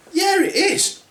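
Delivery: squeaky high dwarf agreeing; high squeaky little dwarf. Acted. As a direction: pitch it way up like a tiny man. Another dwarf answers.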